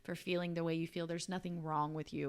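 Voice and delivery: soft, low reassurance to a younger self; quiet, tender voice soothing her thirteen-year-old self; tender self-comfort. Retold or imitated. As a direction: comfort a hurt child gently. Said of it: "to my thirteen-year-old self".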